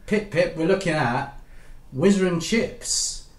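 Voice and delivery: hearty officer, slow on the title; Squadron Leader voice. Acted. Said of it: "Squadron Leader?".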